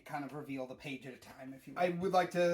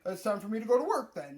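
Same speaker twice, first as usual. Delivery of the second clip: higher, louder mock-casual villager; unbothered townsperson; 6 semitones higher, 7 dB louder than usual. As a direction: shrug it off like a daily routine. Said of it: "a lot of them are just like".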